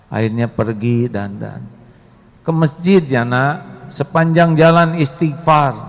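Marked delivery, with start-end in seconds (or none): none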